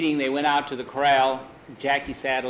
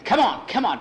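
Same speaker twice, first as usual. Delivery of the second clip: urging shout; 8 semitones higher, 4 dB louder than usual. Acted. Urging horse in race.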